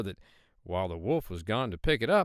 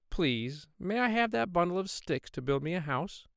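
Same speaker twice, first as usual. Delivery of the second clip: high, sweet little pig voice; 8 semitones higher than usual. Acted. Little pig politely asks the man for sticks, high piggy voice. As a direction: play the small polite pig asking nicely.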